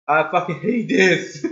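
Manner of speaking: voiced-out angry complaint; frustrated outburst. Real, not acted. Player hating having to redo the chase, open frustrated outburst. Louder repeat of muttered curse.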